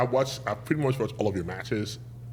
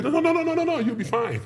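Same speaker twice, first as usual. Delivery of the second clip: very high, loud rapid string of no's; mock high-pitched brush-off; dismissive reassurance; 4 dB louder than usual. Trainees waving away his worry about pants, high mock reassurance.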